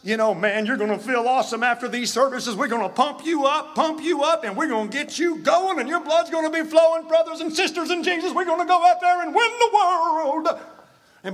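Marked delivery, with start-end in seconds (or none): 0.3-10.5 high-pitched, revved-up pump-up pitch, building to a loud peak; hammed-up, rising church hype; hype preacher. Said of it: "if another church offers, you know".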